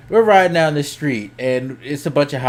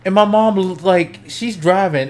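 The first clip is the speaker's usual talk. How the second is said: normal talking voice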